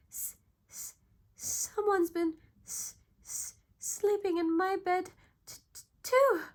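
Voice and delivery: high, loud, frightened stutter; stuttering Baby Bear. A narrator's reading. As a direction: stammer each word like a scared little cub. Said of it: "stuttered Baby Bear".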